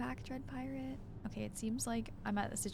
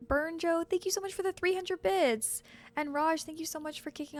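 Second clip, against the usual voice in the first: excited thanks; 8 semitones higher, 5 dB louder than usual. Real, not acted. Thanking for a bits donation.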